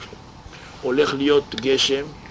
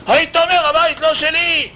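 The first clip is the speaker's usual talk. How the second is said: much higher, loud exasperated shout; rabbi shouting; 14 semitones higher, 4 dB louder than usual. Acted. Rabbi yelling at the tenant for wasting his time, loud shout. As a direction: yell it like a fed-up rabbi. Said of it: "אל תצעק".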